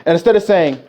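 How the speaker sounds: ordinary talk, nothing marked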